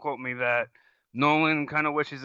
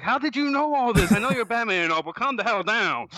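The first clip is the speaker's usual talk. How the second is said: high, loud, incredulous rant; hammed-up disbelief at a know-it-all; mock exasperation; 10 semitones higher, 4 dB louder than usual. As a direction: protest in a raised, pitched-up voice. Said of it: "It's like".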